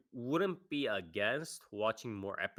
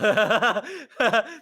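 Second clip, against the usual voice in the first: loud high laugh; 11 semitones higher, 13 dB louder than usual. Real, not acted. Big laugh after joke.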